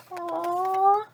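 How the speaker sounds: plain reading voice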